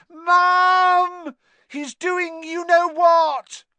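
high, loud panicked wail; terrified tattletale's howl. A narrator's reading. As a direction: shriek for help, drawing it out. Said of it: "howled Dudley".

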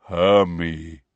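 low, rumbling giant voice; deep rumbling giant. A narrator's reading. Giant recognising the girl, deep slow rumble. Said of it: "said in a deep, rumbling voice".

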